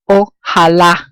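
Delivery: ojalá, slowly, landing on LÁ; final-syllable stress. Shown as a demonstration. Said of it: "stress coming at the end".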